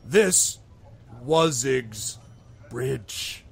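halting, pleased troll with a big grin; grinning troll. Acted. Troll claiming his bridge, grinning and halting. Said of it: "then he gets this big grin".

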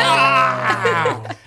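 sudden high loud cry of dismay; shocked yelp. Retold or imitated. Student reliving opening his exam results with a shocked cry. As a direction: yelp out loud like the bad grade just hit you.

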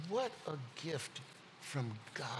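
whispered in awe; awed whisper. Real, not acted. Preacher marvelling at God's gift to the congregation, whispered. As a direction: breathe it out in wonder.

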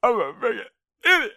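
high-pitched self-berating outburst; hammed-up drunken self-loathing; acted meltdown. Movie meltdown line, repeated and escalated.

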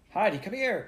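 high, loud call across the slope; shouting for help. A narrator's reading. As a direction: call the girl over urgently. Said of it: "called Peter".

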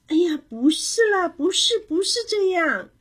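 put-on high, loud child's objection; exasperated protest. A narrator's reading. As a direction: insist they have it all wrong. Child protests again, more upset. (着急地高声否认)

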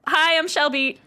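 ordinary delivery

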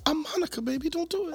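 high falsetto mock plea; imitating pleading lady. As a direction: beg the officer in a put-on woman's voice. Retells lady pleading with officer.

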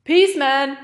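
loud, high postman's goodbye; shouted farewell. A narrator's reading. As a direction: call out a breezy parting shout. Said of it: "verabschiedete sich".

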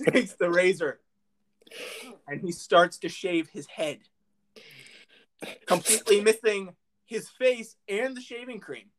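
usual speaking voice

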